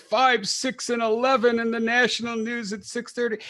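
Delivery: put-on old-timer voice, cracking up; old man voice. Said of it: "Yeah, I see if I can keep that up".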